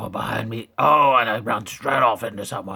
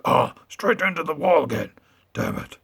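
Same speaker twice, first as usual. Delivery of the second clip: normal talking voice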